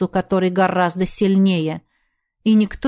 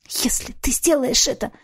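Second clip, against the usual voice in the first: cracking tearful heartbreak; 4 semitones higher, 3 dB quieter than usual. Acted. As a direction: voice breaking into a rasp. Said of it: "Её голос сорвался на хрип".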